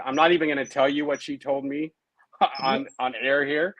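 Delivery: usual speaking voice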